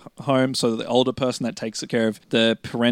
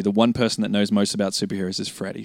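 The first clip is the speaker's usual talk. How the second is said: normal talking voice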